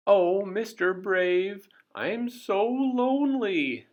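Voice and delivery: high, slow sobbing plea. Acted. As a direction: wail it like a lonely child in tears. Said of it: "she sobbed".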